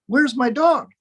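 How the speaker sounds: light mock alarm, pitch up; alarmed owner. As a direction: act out an owner missing his dog. Owner finds his dog gone.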